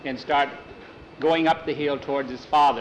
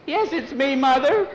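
put-on little girl; 11 semitones higher, 4 dB louder than usual. A narrator's reading. Girl answers mother.

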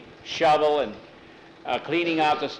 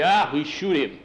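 dismissive quote. Retold or imitated. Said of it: "all he can say".

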